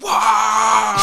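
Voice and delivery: loud, high mock war cry; battle cry. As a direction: charge with a silly battle yell. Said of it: "He'd just go".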